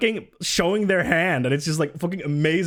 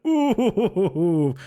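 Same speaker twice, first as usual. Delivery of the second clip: long, wavering higher "ooh"; teasing scandalized ooh with a laugh in it; gossipy "ooh"; 4 semitones higher than usual. Real, not acted. Reacting to leaked texts from his wife's friends, teasing.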